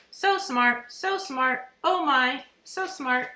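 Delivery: high, loud mynah chatter; bird voice. A narrator's reading. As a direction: squawk the praise back. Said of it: "Uncle Feather said".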